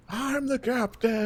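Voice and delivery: high, slow put-on captain whine; mock yelling captain. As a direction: imitate a ranting impostor, lightly. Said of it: "starts yelling about how he's the captain".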